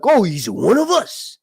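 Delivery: put-on high, loud, slow Italian American accent; welcoming him in, thick Italian American accent. Italian Americans accepting him as one of their own, Italian American accent. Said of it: "do the Italian North American or American voice".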